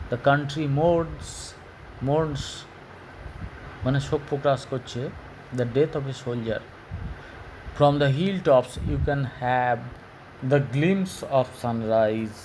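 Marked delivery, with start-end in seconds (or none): 0.1-2.4 mourns drawn out and repeated; slow reading. Shown as a demonstration. Teacher reading a textbook sentence slowly to pupils. 7.8-12.4 crawling, dictation pace; very slow reading. Shown as a demonstration. Teacher reading a textbook sentence slowly to pupils.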